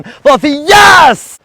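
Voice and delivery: high, slow, loud shout of yes; release shout. Real, not acted. Owner releasing poodle to retrieve, slow loud shout. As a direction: call the dog out with a big drawn-out yes.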